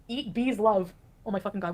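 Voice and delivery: ordinary talk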